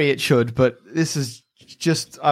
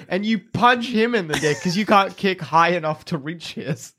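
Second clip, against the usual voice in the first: usual speaking voice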